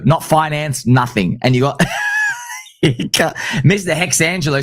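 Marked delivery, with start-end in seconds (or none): 1.9-2.6 sudden high whoop of laughter; high shouted laugh. Real, not acted. Laughing at the Hex crowd's replies, a high whooping laugh.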